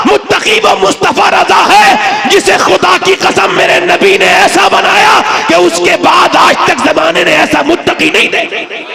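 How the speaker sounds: high, ringing proclamation; fiery preaching. Acted. Preacher swearing to the crowd on a saint's piety, high fiery proclamation. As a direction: preach it high, at full force, drawing out the key words.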